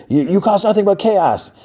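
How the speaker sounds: high-pitched imitation of a scolding; mock exasperated scolding. Acted quarrel, scolding back.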